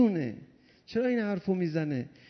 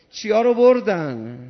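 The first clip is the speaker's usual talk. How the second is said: louder pointed question; loud demand; 7 dB louder than usual. Real, not acted. Preacher demanding the crowd answer, louder. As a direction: press the crowd with it.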